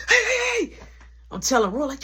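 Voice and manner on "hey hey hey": sharp high hey calls; calling out. Retold or imitated. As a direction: grab a friend's attention from afar. Calling to Raymond while running.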